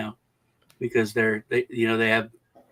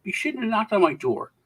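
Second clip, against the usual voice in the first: indignant scolding; 8 semitones higher than usual. Acted. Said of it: "telling off the police".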